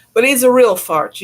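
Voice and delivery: fond teasing emphasis. Real, not acted. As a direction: tease him with affection.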